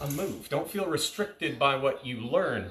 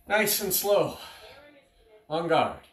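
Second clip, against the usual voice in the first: called command; 6 semitones higher, 5 dB louder, slower than usual. Shown as a demonstration. Calling drill commands.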